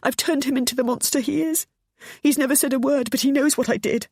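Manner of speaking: high, loud sobbing guilt. Acted. Pammy confessing guilt to her son, sobbing. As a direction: let the sobs push the words. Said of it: "She was sobbing now".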